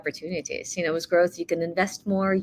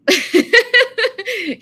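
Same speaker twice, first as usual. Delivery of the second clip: laughing; 10 dB louder than usual. Real, not acted. Laughing at own answer.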